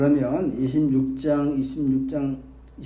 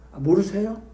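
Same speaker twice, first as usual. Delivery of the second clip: higher rising mock-surprised question; mock surprise; 5 semitones higher than usual. Shown as a demonstration. Pastor feigning shock at the congregation, rising mock surprise. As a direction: act amazed that they don't know.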